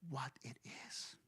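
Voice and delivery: hushed, secret whisper. A narrator's reading. Storyteller revealing the snake's memory, whispered. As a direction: breathe it like a secret. Remembering its nature.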